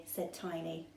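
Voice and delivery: usual speaking voice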